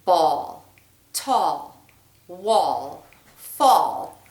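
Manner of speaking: each word said slowly and set apart, stressing the "all" sound; spaced out, a pause between each word; rhyme demo. Shown as a demonstration. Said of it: "I'm going to say them all".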